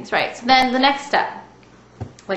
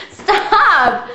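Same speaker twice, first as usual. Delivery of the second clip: laughing stop; 8 semitones higher, 5 dB louder than usual. Real, not acted. Laughing at child.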